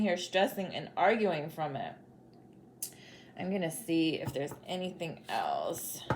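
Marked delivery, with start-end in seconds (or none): 3.4-6.0 whisper. Real, not acted. Aside to herself while shuffling.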